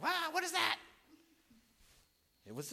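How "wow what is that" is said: high, startled exclamation; mock surprise. Pastor spotting a snake while mowing, high startled cry. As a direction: jump in pitch as if startled. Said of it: "I was like".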